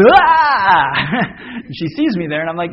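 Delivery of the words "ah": high loud startled shriek; startled scream. Retold or imitated. Wife turning round and seeing her husband, shrieking. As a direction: let out a sudden frightened shriek. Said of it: "and then she goes".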